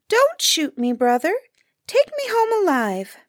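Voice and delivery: high little bird voice, begging; pleading grouse. A narrator's reading. Grouse begs a third time.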